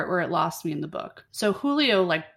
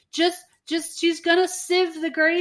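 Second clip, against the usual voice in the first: mock fussy mother; 9 semitones higher than usual. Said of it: "it's like".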